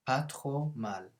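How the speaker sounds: «Pas trop mal» said very slowly for learners; slow model. Shown as a demonstration. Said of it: "model phrase said slowly".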